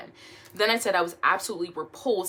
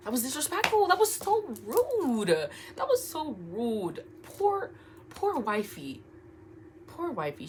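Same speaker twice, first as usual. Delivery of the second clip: dramatic disapproval; 6 semitones higher, slower than usual. Real, not acted. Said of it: "dramatic reaction".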